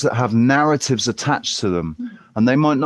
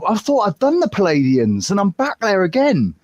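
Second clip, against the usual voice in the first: higher, mock-baffled protest; acted bewilderment; 7 semitones higher than usual. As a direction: play up being lost in circles. Acted bewilderment at going in circles.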